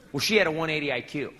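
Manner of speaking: normal talking voice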